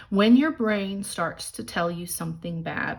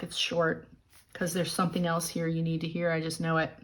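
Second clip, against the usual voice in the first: normal talking voice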